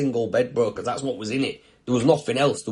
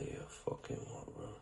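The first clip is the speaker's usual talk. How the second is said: stunned whisper; 20 dB quieter than usual. Retold or imitated. Shocked reaction to news.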